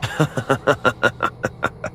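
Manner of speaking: breathy evil chuckle; sinister laugh. Acted. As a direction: laugh like a killer toy.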